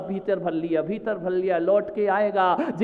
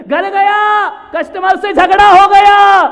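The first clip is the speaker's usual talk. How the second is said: high, full-force shout; thundering narration; 11 semitones higher, 12 dB louder, slower than usual. Real, not acted. Preacher telling of the quarrel with customer, shouted. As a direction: bellow each consequence out.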